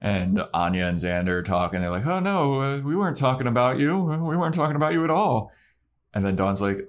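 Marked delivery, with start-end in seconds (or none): 2.0-5.5 high, quick mock denial; hammed-up guilty cover-up; acting weird. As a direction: deny it far too eagerly. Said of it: "Xander and Anya start to act weird".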